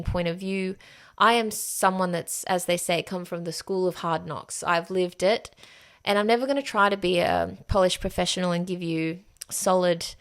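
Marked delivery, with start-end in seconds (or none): none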